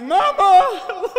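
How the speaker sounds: loud, high wailing call half-laughing; crying for mama. Retold or imitated. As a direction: wail for mama as a hurt kid. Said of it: "went upstairs crying".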